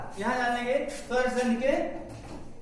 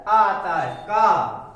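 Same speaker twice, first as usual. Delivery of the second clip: slow, drawn-out answer at a louder level; 5 dB louder, much slower than usual. Shown as a demonstration. Teacher slowly answering her own question to the class. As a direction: spell the sound out slowly for the class. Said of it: "इसका उच्चारण क्या आता है?".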